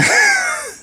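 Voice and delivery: a burst of high laughter at own joke. Real, not acted. Laughing at his own joke to viewers, high laugh.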